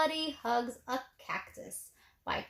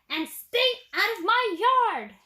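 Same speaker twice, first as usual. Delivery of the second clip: high, louder angry yell; 8 semitones higher, 5 dB louder than usual. A narrator's reading. Cactus yelling at the jackrabbit to stay out. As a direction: chase them off your yard. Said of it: "Hank yelled back".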